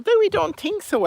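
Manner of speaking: very high little chick refusing; high chicks refusing to swim out. Acted. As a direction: squeak a nervous no.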